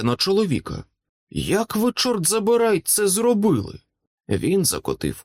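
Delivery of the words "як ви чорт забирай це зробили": much higher-pitched incredulous question; astonished demand. A narrator's reading. Shaken wizard asks the centurion how he did it, astonished. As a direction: push the pitch right up in disbelief.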